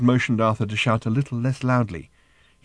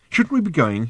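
sudden; 8 semitones higher, 4 dB louder than usual. A narrator's reading. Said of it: "he said suddenly".

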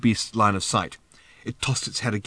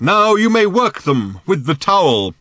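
loud, high, slow proclamation; exclaimed; 8 semitones higher, 7 dB louder than usual. A narrator's reading. Thrashbarg telling Arthur to work the beasts, exclaiming. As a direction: call it out grandly, drawn out. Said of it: "exclaimed old Thrashbarg".

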